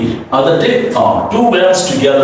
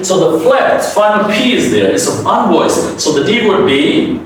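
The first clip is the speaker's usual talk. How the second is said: usual speaking voice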